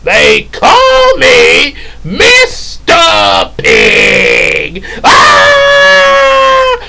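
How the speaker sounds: very slow, high furious shout; Pumbaa roar. Retold or imitated. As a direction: bellow it with a roar at the end. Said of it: "what Pumbaa did in The Lion King".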